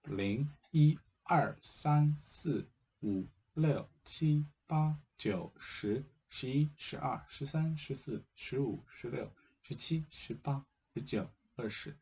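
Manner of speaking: Mandarin numbers counted out clearly; steady Mandarin counting drill; counting in Chinese. Shown as a demonstration. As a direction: count zero to twenty in Mandarin. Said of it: "In Chinese, it will be".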